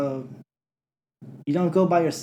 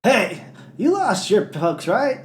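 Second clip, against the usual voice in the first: mock eager kid; 6 semitones higher, slower than usual. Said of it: "He's just like".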